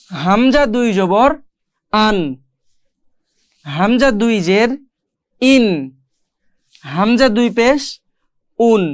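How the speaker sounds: "আন", "ইন", "উন" read slowly with a clear nasal n ending; slow tanween drill. Shown as a demonstration. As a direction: spell out each tanween slowly, then land on "আন", "ইন", "উন". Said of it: "আমি এখন পড়ায় বুঝাই দিই".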